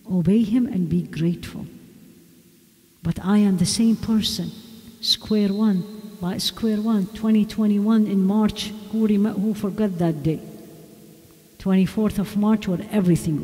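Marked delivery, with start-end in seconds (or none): none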